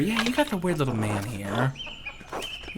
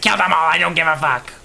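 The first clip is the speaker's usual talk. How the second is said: loud, raised mock ranting; mock outburst; 5 semitones higher, 8 dB louder, faster than usual. Player imitating Ottilia's hatred of the church, mock outburst. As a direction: blurt it as a hammed-up rant. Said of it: "you're just like".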